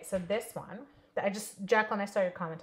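ordinary delivery

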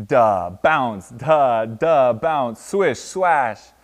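loud, bouncy sound words; punchy sound effects. A narrator's reading. Narrator voicing noises that disturb Tortoise, punchy. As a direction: punch each noise word out. Story's noises read out vividly.

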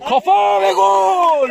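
screaming football commentator. Acted. Goal call in match commentary.

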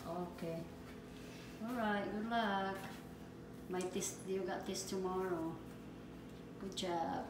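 ordinary talk

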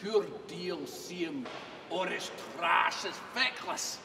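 booming dramatic Scottish recitation; poem recitation. Acted. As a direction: declaim the poem with full theatrical force. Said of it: "the address to a haggis".

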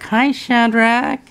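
high, slow coo on "Hi"; puppy greeting. Real, not acted. Greeting puppy in baby talk.

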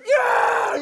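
very high, loud mock roar; hammed-up fan holler; imitated crowd cheer. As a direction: yell it like a whole crowd of fans. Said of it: "everybody goes".

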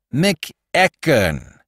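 McEachern drawn out syllable by syllable; over-careful, bell-clear McEachern; slow, clear enunciation. Acted. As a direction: enunciate McEachern slowly, each part set apart. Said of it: "enunciate slowly and clearly. Be bell-like".

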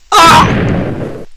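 sudden loud, high yelp; abrupt piercing cry of fright; startled scream. Real, not acted. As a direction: scream in real shock. Startled mid-sentence by the game.

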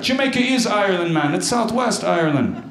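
high Jamaican lilt, insisting; mock Jamaican accent. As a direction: argue back in a hammed-up Jamaican accent. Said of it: "That's Jamaica, not Ireland".

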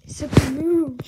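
loud, sharp "So move"; loud command. Real, not acted. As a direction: shout at the cat to shift. Yelling at cat.

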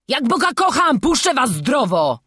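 loud, high, urgent promise; insistent prisoner; pleading oath. A narrator's reading. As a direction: swear it desperately to the boys. Prisoner swears to free boys.

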